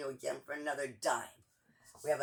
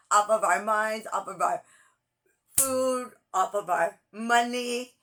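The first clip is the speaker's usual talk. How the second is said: loud and higher, hammering each phrase; rally shouting; 6 semitones higher, 12 dB louder than usual. Real, not acted. Urging Americans to march, shouting at full voice. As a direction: shout it like a protest rally speech.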